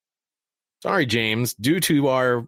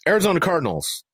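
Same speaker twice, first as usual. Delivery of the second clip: usual speaking voice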